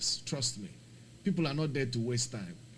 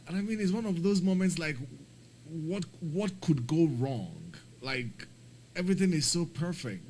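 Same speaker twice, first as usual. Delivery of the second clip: exasperated; 6 semitones higher, 6 dB louder than usual. Real, not acted. Exasperated rant about the failed flight.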